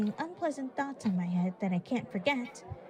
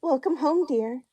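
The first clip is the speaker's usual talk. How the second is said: higher, louder sweet mother's greeting; sweetly; 5 semitones higher, 8 dB louder than usual. Acted. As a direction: greet her daughter sweetly, brightly up. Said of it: "Lily said sweetly".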